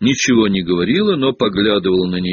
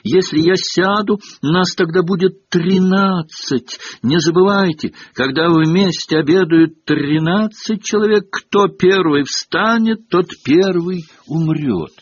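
alarmed cry; 9 semitones higher, 4 dB louder than usual. A narrator's reading. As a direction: cry out in alarm like a doom prophet. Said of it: "и вдруг вскрикнула".

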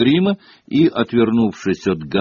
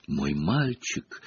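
slow, soft, low sigh of pity; 5 semitones lower, 7 dB quieter, much slower than usual. A narrator's reading. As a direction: sigh it out gently and very slowly. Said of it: "вздохнула профессор". (медленно, тихо, со вздохом)

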